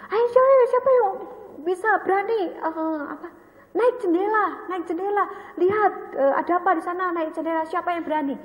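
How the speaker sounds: put-on high, quick playful child's dare; daring child cousin. Cousin daring the children to climb the window, high and playful. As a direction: egg the other kids on like a cheeky ten-year-old.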